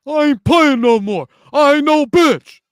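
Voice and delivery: loud mock tough-guy voice. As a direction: yell it like a wannabe thug. Mocking the kid's quote.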